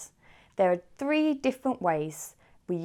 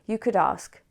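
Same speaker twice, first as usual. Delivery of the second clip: ordinary talk, nothing marked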